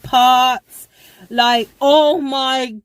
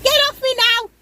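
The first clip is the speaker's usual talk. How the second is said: high desperate yell; retold panicked scream; 13 semitones higher, faster than usual. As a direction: shriek it at him. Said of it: "Like that".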